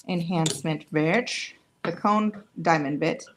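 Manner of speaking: usual speaking voice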